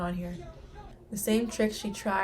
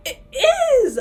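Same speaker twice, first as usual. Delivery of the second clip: high, loud squeal; thrilled surprise; 12 dB louder than usual. Real, not acted. Viewer seeing the new outfit, squealing in surprise. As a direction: burst out in delighted astonishment.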